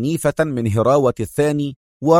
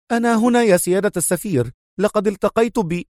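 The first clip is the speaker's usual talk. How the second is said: higher, quick woman's voice; female major voice; 8 semitones higher, faster than usual. Acted. The major reassuring the ambassador on the phone, high and quick. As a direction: report in fast to the ambassador. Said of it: "وهي تقول".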